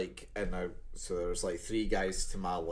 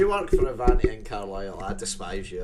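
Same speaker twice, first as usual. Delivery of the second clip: mock angry rant; 10 dB louder than usual. Mock rant at train company.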